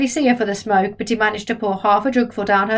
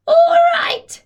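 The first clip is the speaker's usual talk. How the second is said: very high, loud screech; yelled; 7 dB louder than usual. Acted. Grandma screeches back at George. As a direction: screech it in outrage. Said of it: "she yelled".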